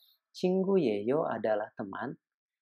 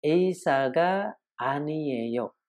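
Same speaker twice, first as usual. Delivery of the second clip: slow Korean demo; 3 semitones higher, much slower than usual. Shown as a demonstration.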